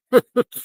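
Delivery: a quick loud laugh that breaks out; short burst of laughter. Real, not acted. Watching floodwaters rise, a sudden loud laugh. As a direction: laugh despite yourself.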